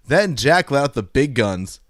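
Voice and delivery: ordinary delivery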